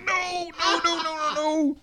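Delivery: high, softened mock-panicked squeal; panicked pleading. Retelling his alarmed plea to streamer.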